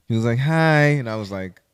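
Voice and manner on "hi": a light, raised greeting in his voice; imitating his hi. Stranger greeting him on the street, lightly imitated. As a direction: say hi the way the stranger did. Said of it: "try to say it like how he said it".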